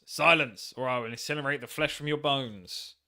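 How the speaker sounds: loud burst on "Silence", then easing; hammed-up villain command; acted angry threat. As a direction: play a wrathful ghost cutting someone off. Voicing Necrovarus threatening the player.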